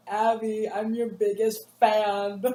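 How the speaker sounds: slow, a hint of a creepy admirer; lightly voiced clingy fan. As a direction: lean in like the kid who won't leave. Clingy kid addressing Abby.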